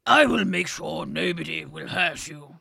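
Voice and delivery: slow, loud, gruff posh king; old and wise and a bit posh and gruff. Acted. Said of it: "this is what I'm going for".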